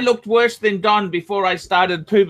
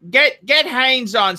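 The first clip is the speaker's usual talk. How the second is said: normal talking voice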